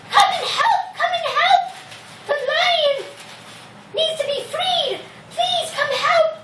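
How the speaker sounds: very high, urgent mouse voice; mouse calling for help. Acted. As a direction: pipe out a plea as a tiny mouse. Mouse calls the other mice for help.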